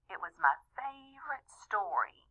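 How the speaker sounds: ordinary delivery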